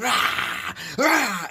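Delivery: high, repeated attacking "rah"; imitated dog snarls. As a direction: mimic dogs snarling in a scrap. Imitating both dogs fighting.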